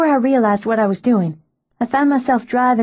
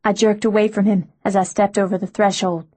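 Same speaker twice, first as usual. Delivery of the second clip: ordinary delivery, nothing marked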